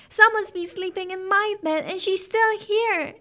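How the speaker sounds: high, loud little bear voice; exclaiming baby bear. A narrator's reading. Baby bear finding Goldilocks in bed, exclaiming high and loud. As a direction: cry out in a high child-bear squeal. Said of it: "exclaimed the baby bear".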